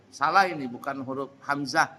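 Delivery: ordinary talk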